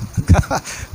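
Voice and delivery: quick breathy chuckle; small amused chuckle; short laugh. Real, not acted. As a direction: laugh briefly after the quote. Preacher laughs after voicing Leah.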